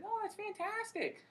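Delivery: very high, slow mock falsetto; hammed-up sugary enthusiasm in falsetto; gushing advisors. Advisors praising a student's life plans, mock gushing.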